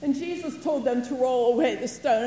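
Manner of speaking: ordinary talk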